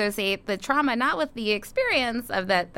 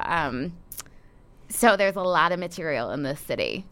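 normal talking voice

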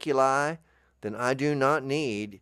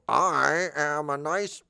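put-on voice; 6 semitones higher, 3 dB louder, much slower than usual. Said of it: "I'll go".

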